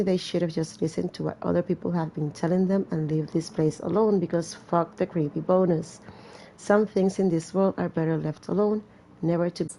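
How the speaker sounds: ordinary talk, nothing marked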